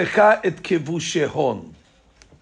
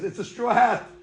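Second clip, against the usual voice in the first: ordinary talk, nothing marked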